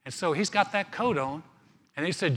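ordinary talk

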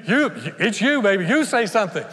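loud, high, stammered plea; hammed-up nervous pleading; mock flustered deflection. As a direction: hand it back in a hurry. Flustered believer deflects to Jesus.

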